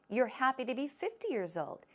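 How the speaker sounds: ordinary talk, nothing marked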